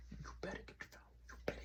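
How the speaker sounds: hushed, voiceless urging; urgent whisper. Acted. Sister to brother while the phone is muted, urgent whisper. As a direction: whisper it so the phone won't hear.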